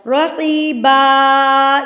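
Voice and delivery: رقیبا with the final a drawn out long; long alif stop. Shown as a demonstration. Teacher showing the stop on two zabars, رقیبا ending long. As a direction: stretch the last a of رقیبا slowly. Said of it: "لمبا کر کے پڑھ دینا ہے".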